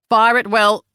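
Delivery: loud officer's command. Acted. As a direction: give the order to fire, crisp and loud. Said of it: "Willoughby commanded".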